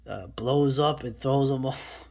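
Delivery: ordinary talk, nothing marked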